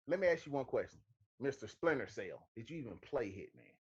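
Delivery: ordinary talk, nothing marked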